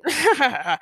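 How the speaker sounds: high villain cackle; acted evil chuckle; evil laugh. A narrator's reading. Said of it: "Penguin laughed evilly".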